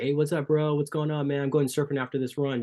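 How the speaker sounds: high, easygoing mock surfer greeting; laid-back SoCal. SoCal runners greeting each other, imitated laid-back chat. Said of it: "it's all laid back".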